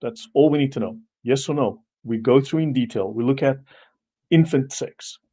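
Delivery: normal talking voice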